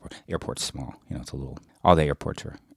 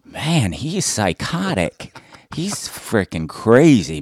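mock disbelief; 6 semitones higher, 4 dB louder, much slower than usual. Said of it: "They're like".

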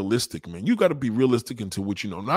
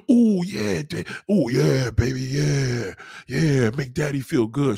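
mock pleasure moans; much slower than usual. Man enjoying it, acted.

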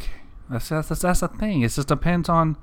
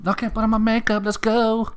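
mock princess; 10 semitones higher than usual. Continues the mock princess bit.

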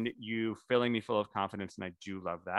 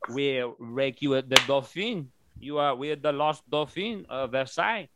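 put-on vague French accent. French people thanking Tony, in a vague mock French accent. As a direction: imitate a French official, barely landing the accent. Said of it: "I don't know what accent that is".

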